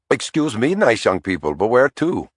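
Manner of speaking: put-on a light Indian accent, friendly cabbie; a hint of an Indian cabbie asking where to. A narrator's reading. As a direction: politely ask in a mild Indian accent. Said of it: "with an Indian accent".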